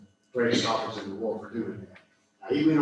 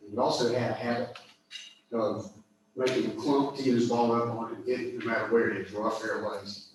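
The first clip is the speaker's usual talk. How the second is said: ordinary talk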